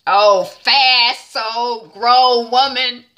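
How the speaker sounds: slow, loud drawn-out sass; sassy. Acted. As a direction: stretch it out, impressed and mocking. Mocking Helen's grown-woman move.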